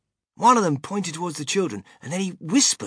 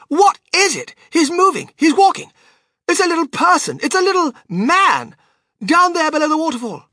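put-on very high, loud frantic girl; excited scream; 14 semitones higher, 9 dB louder than usual. A narrator's reading. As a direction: shriek each discovery in amazement. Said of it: "she screamed".

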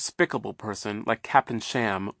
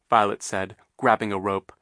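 ordinary delivery

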